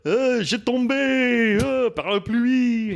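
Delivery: high, drawn-out French wail; falling puppet pineapple. Acted. Puppet pineapple whose parachute umbrella fails, high wail. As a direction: cry out in French as if plummeting. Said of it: "the pineapple's parapluie doesn't open".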